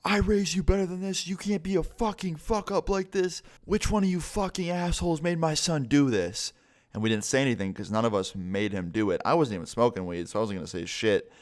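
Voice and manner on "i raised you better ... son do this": high, mocking furious rant; imitating dad cussing. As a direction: rant at the teens like the enraged dad. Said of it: "he keeps cussing my friend out".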